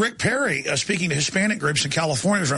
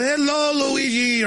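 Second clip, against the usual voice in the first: high, hammed-up Italian accent; cartoonish put-on Italian accent; fake Italian accent; 7 semitones higher, slower than usual. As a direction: greet in a mock Italian accent. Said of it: "some kind of fake Italian accent".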